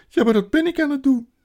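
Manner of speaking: high, loud, fast protest; woeful outburst; wailing faun. A narrator's reading. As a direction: insist in a desperate high wail. Weeping faun insists he is guilty.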